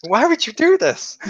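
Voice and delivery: high, pleading wail; hammed-up why; mock anguish. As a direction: cry out at the betrayal, half joking.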